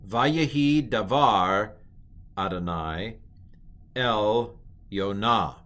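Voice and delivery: slow Hebrew reading with gaps between phrases; model reading. Shown as a demonstration. Teacher reads the verse so far, slow model reading. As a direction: read it out word by word, slowly.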